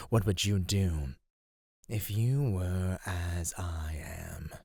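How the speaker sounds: slow, intimate whisper. Acted. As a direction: draw the question out in a whisper. Creature confides to captive.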